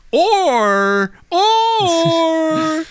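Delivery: stretched high or, Picard toying; drawn-out high or. Retold or imitated. Picard teasing the envoy with a long high or.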